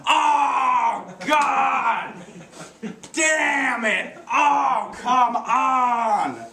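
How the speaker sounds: loud, high outraged yell; drawn-out, high-pitched bellow of dismay; exasperated shouting. Acted. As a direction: howl in protest at a spoiled ending. Outraged viewer hearing the spoiler.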